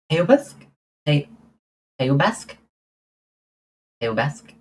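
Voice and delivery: sounding out Teubask with a questioning lift; tentative pronunciation. Shown as a demonstration. Trying to say I love you in Romanian for a viewer, tentative. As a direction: try Teubask haltingly, unsure of the sounds. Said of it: "how to say it?".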